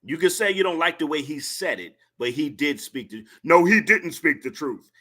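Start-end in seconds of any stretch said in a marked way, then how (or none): 3.4-4.9 mocking, a touch louder, in a put-on voice; mocking commenter voice. Streamer mocks a troll's comment, in a louder put-on mocking voice. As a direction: parody the commenter's whiny denial. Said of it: "commenter quoted again".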